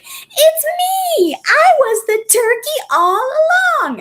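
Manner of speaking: very high, shrill excited robot squeal; squeaky Gurr robot. Retold or imitated. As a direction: squeal it like a manic little robot. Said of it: "and he's like".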